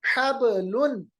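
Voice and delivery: slow हबलुन with bounced b; qalqalah. Shown as a demonstration. Teacher gives the next example, हबलुन with springing b. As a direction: echo the b in हबलुन before lun. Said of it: "बाकी मिसाल".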